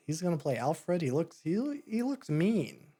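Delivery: half-joking disbelief at the casting news; incredulous disbelief. Retold or imitated. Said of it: "but I'm like".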